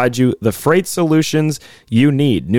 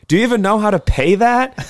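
much higher, put-on skeptical girlfriend; mock girlfriend voice; 9 semitones higher than usual. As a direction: ask it like the sober one. Sober girlfriend questions drunk boyfriend.

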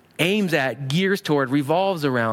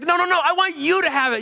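high, eager refusal; generous insistence; 13 semitones higher than usual. Acted. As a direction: push the gift back insistently. Acted friendly tussle over giving.

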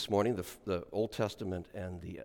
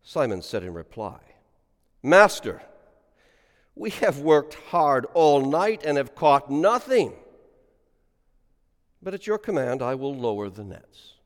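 solemn liturgical reading aloud; gospel reading; 6 semitones higher, 11 dB louder than usual. Acted. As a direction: intone the gospel for the congregation.

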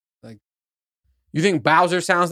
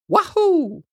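very high cartoon game-hero whoop; high-pitched, playful impression; Mario. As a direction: squeal it like a video game catchphrase. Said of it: "what his voice sounds like".

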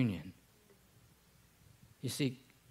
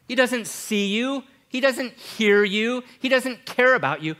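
loud ringing declaration; 10 semitones higher, 10 dB louder than usual. Acted. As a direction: proclaim it to the back row. Preacher voicing a bleak claim.

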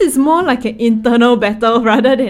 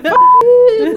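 much higher, louder, laughing; careless mock shrug; 10 dB louder than usual. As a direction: toss it off carelessly. Casual mock shrug quoted.

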